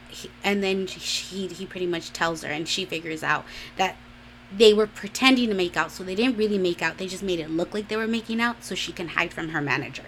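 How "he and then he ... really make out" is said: ordinary delivery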